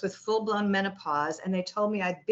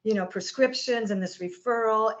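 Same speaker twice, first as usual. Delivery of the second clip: usual speaking voice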